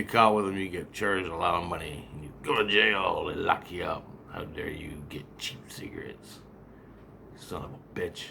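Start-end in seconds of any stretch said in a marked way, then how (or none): 4.3-8.2 low, very soft mock reprimand; mock scolding. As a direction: scold under your breath, in mockery. Voicing the cigarette law's scolding.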